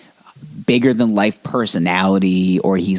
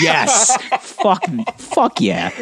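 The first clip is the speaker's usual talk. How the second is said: laughing cheer; 5 semitones higher, slower than usual. Retold or imitated. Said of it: "I'm like".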